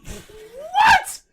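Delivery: high, loud cry of disbelief; stunned high-pitched outburst; incredulous shout. Real, not acted. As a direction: yell it in sheer disbelief.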